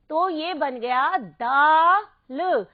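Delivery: very slow, drawn-out दाल; stretched model word. Shown as a demonstration. Teacher showing the picture, draws out दाल. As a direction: hold दाल long and clear. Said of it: "आ की".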